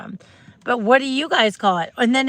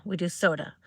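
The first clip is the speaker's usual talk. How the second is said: ordinary delivery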